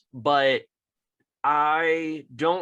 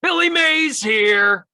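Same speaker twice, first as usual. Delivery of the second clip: high, full-volume pitchman shout; Billy Mays scream; 12 semitones higher, 9 dB louder, slower than usual. Acted. Imitating a pitchman bursting into a room, screamed. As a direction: bellow a slow, booming announcement of yourself. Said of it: "compulsory that he had to scream".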